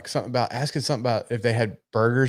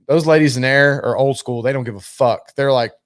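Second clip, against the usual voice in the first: normal talking voice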